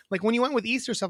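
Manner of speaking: normal talking voice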